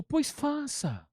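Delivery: high, drawn-out retold retort; réplica seca encenada. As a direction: stretch it out slowly as a pointed comeback. Réplica encenada do pastor amigo. (réplica aguda e bem arrastada)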